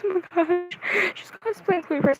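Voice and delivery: high-pitched fangirl gush; squealing fangirl. Retold or imitated. Fan reacting to a Chloe Price cosplayer, squealing. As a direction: gush it high, barely containing yourself. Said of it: "start squealing".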